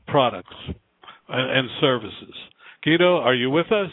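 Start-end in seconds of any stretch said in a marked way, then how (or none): none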